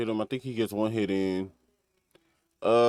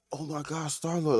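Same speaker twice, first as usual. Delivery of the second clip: high, breathless fanboy squeal; fanboy gush; 8 semitones higher than usual. Retold or imitated. Retelling the hero gushing over Star-Lord. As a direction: gush like a starstruck fan. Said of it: "straight fanboying over the man".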